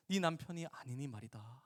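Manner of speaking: ordinary delivery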